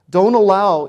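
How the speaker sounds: usual speaking voice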